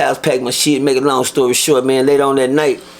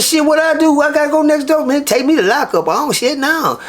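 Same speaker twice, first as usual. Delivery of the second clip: high, whiny put-on panic, much higher; mock panicked whine; 11 semitones higher than usual. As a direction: play a scared kid begging not to go. Mock panicked reaction to transfer.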